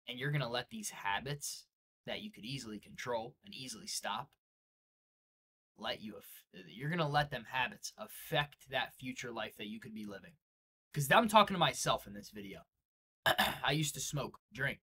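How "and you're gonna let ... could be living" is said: whispered, much softer, grave; hushed warning. Acted. Motivational speaker warning viewers about habits, whispered. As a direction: drop to a hush to warn them.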